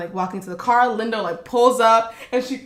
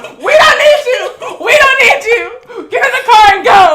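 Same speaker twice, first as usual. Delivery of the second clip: hysterical mock shouting; 13 semitones higher, 12 dB louder than usual. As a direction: scream it up high like she's losing it. Linda yelling in the intersection.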